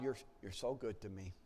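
ordinary talk

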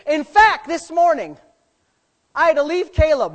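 loud, high, emphatic preacher; full-force preaching. Real, not acted. Pastor insisting his kids are happiest, full-force preaching. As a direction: proclaim it proudly, punching the big words.

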